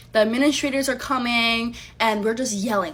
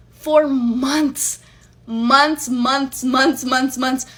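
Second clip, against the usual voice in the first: "months" hammered louder each time; emphatic repetition; 3 semitones higher, 6 dB louder, much slower than usual. Real, not acted. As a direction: pound every "months" in outrage. Hammering the length of the affair.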